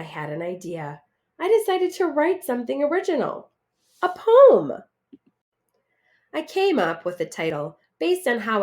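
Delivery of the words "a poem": high, loud, thrilled; excited discovery. Acted. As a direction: announce it like a revelation. Announcing his idea.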